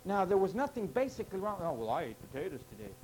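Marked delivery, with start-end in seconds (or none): 1.6-2.9 fast, lower, softer mock voice of a self-satisfied eater; lightly voiced smug eater, quick and low; mock excuse. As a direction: toss off the excuse like a smug churchgoer. Mocking a complacent eater.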